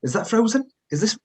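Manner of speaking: mock Ramsay. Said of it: "he's meant to be Scottish".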